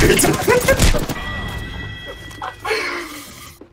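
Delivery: long high wheezing laugh; breathy high laugh. Real, not acted. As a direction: dissolve into helpless laughter. 被夹击后大笑. (气声长笑)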